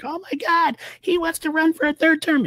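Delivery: high mock panic; mock panicked crowd. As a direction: squeal it like a panicking fan. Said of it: "They're like".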